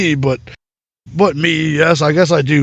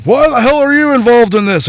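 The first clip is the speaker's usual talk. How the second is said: annoyed demand; 8 semitones higher, 4 dB louder than usual. Acted. Old man questions Death.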